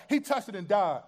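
ordinary talk

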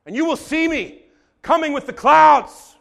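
high, loud declaration; Jesus proclaiming. Retold or imitated. Jesus proclaiming his return, loud shout. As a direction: announce it boldly. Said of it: "He says".

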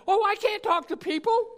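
loud, high-pitched excuse-making; mock protest. Believer makes excuses to Jesus.

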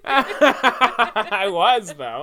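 high, loud words through laughter; laughing protest. Real, not acted. Teased speaker defending churchgoing past, laughing protest. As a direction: insist while cracking up.

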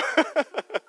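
high burst of laughter; real chuckling laugh. Real, not acted. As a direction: laugh at your own joke. Laughs at his own joke.

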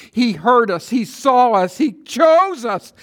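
loud, high, slow voicing of God's promises; fervent preaching. Preacher voicing God to the congregation, loud and fervent. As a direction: build each line like a proclamation.

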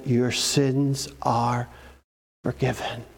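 low, slow and soft; tender forgiveness. Retold or imitated. Jesus looking in the woman's eyes, low and tender. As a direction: lay it gently on her like a blessing.

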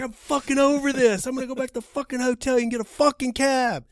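high, loud, fed-up rant; retold screaming. Fighter giving up on his airport ride, screaming. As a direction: act out a caller yelling that he quits. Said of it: "'cause he's screaming".

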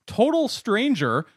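total stranger said slow and loud, stress on total; quote voice. Retold or imitated. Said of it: "a, quote".

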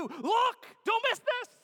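high, loud urgent shout; yelled. Acted. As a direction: shout the writer's alert. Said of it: "Matthew yells".

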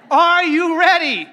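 loud, high and drawn out. Real, not acted. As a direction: demand a bigger answer. Asks again after weak response.

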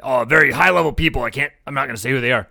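mock a man holding forth; retold quote. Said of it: "He goes".

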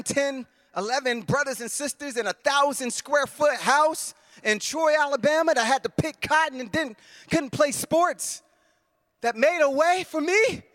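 very high, straining, tearful preaching; overcome with emotion. Real, not acted. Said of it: "I feel the weight".

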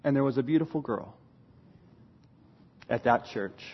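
very low, soft murmur; hushed aside. Real, not acted. Speaker hints at the girl at church, hushed. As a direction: drop it in as a sly, quiet aside. Recurring aside about future wife.

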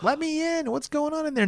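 very high mock-mother voice; imitated mom at door. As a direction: falsetto mom demanding entry.

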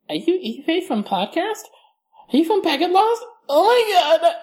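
a high, excited fan squealing at a celebrity. Retold or imitated. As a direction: squeal it like a fan who just spotted a star. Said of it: "be like".

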